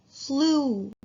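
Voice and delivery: flu said high with a long oo; model word flu. Shown as a demonstration. Teacher models flu for students to repeat. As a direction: sing out flu with a stretched oo. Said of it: "One more time".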